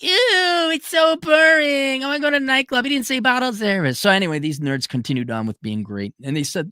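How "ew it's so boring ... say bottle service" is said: much higher, whiny put-on girl voice; mock valley girl. Girls mocked as bored by the asteroid mission, high whiny voice. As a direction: squeal it like a bored party girl. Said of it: "And you girls are like".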